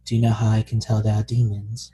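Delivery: low, hurried fanatic accusing someone; Sister Iris impression. Podcaster playing the zealot nun, mock-menacing. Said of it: "first time she meets Nimue is like".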